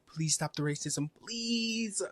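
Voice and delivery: mock pleading, last please stretched high. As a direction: beg them, drawing out the final please. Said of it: "If we say, like".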